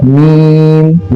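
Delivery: मीम with a very long, even ee; elongated madd. Shown as a demonstration. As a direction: stretch the ee of मीम steadily. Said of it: "पांच अलिफ के बराबर खींचेंगे".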